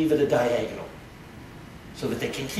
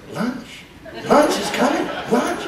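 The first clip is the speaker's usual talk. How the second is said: eager bear with rising, higher pitch; hungry bear; 7 semitones higher than usual. Acted. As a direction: perk up like a bear hearing lunch. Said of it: "bear goes".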